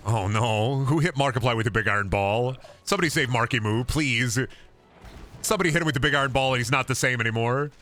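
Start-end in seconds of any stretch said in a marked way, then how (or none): none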